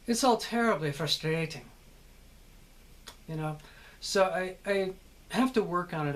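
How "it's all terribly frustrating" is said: light British, musical on the syllables; British accent. Shown as a demonstration. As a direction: put on a playful, lilting British accent. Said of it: "that's where you get the British".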